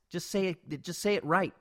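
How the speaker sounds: normal talking voice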